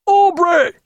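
loud, high-pitched angry summons; hailing. A narrator's reading. Senior captain to Aubrey across water, loud high hail. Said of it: "hailed Miller".

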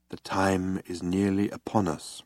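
soft, low, reverent character voice; hushed solemnity. Acted. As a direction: say it quietly, awaiting a great moment. Solemn man waiting before the terminal.

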